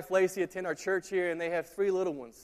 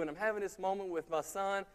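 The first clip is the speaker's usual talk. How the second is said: usual speaking voice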